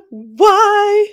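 high, loud drawn-out wail; mock wail. Co-host lamenting her scan results to coach, mock wail. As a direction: cry it out in comic despair.

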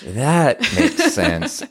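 amused realization, laughing. Retold or imitated. Host imagines the disciples finally getting it, slow laughing realization. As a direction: stretch it out, chuckling as it clicks.

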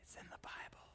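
secretive whispered reveal. Acted.

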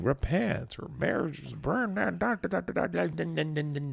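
high-pitched mock catcall; mock heckling. Mimicking homophobic catcallers at a Texas speech, mock heckling. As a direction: imitate hecklers ranting, trailing into high gibberish. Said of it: "They're screaming out nonsense".

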